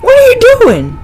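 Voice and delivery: very high shriek then high shocked question; jump-scare scream. Real, not acted. Gamer jump-scared by the mannequin, very high scream. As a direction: cry out in fright.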